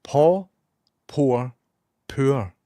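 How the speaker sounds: three separate Scottish words, paw, pour, poor; distinct Scottish vowels, sounded r's, deliberate pauses; Scottish accent. Shown as a demonstration. Scot proving his accent differentiates three words, crisp Scottish accent. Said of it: "Our fantastic accent can clearly differentiate".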